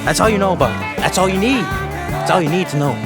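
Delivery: ordinary talk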